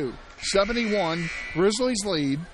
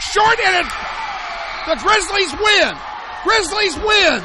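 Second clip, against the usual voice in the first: much higher and louder triumphant yell; victory shout; 9 semitones higher, 7 dB louder than usual. Real, not acted. Radio announcer calling the home team's win to fans, jubilant shout. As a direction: erupt as the shot falls short. Buzzer, home team wins.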